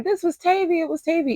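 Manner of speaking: high-pitched chorus of finger-pointing; imitated accusers. Everybody pinning the attack on Tavy, high mocking imitation. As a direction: pitch it way up, mimicking the blamers.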